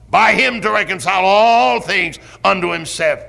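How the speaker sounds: full-force revival preaching, rising and booming on key words; fiery preaching. Acted. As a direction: thunder it from the pulpit. Preaching on the blood atonement.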